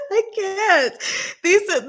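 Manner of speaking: high, breaking up laughing; helpless giggle. Real, not acted. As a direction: overcome with laughter. Cracking up at kinks.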